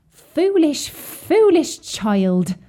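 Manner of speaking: a mild touch of a distressed mother's sorrow. A narrator's reading. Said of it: "looking quite distressed".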